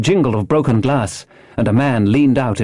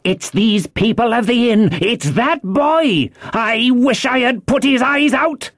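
high, raging outburst; angry cry; 13 semitones higher than usual. A narrator's reading. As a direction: spit blame at high pitch, stretched out. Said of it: "cried the blind man Pew".

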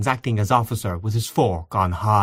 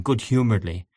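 ordinary delivery, nothing marked

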